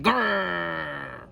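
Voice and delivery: long rolled grrr, fading off; tiger growl. Acted. Tigers answering the boy, long wordless growl. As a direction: answer with a sustained animal growl. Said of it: "tigers only answered".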